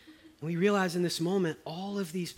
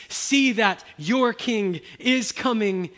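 loud, raised, slow proclamation; fervent preaching; 7 semitones higher, 8 dB louder, slower than usual. Acted. Preacher calling the congregation to see, loud slow proclaiming. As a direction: call the whole room to look.

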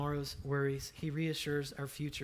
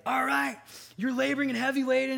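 coach in huddle; 8 semitones higher, 7 dB louder than usual. Retold or imitated. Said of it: "He's like".

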